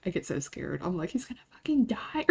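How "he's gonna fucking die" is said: voiceless and hushed; panicked whisper. Retold or imitated. To viewers, paranoid about Hunter dying, whispered. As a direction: whisper the fear like a secret. Imagining Hunter hurt on trips.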